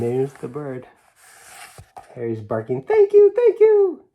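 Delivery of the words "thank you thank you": very high, loud squeaky thank-you; a tiny dog bursting with gratitude; excited dog voice. Acted. Harry the dog thanking the bird, squeaky high and loud.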